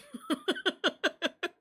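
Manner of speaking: a short real laugh at his own joke. Real, not acted. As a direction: break into a real chuckle.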